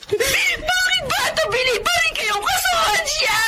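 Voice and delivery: crying angry protest. Acted. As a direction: throw it at her mother through tears. Daughter confronts mother, crying. (umiiyak na pagsumbat)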